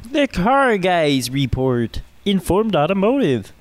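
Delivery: slow, hammed-up Kermit impression; Kermit the Frog voice. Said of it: "poor Kermit the Frog impression".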